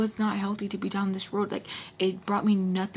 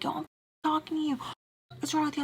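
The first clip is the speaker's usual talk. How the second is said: imitating angry friend; 6 semitones higher, much faster than usual. Joe confronts her in street.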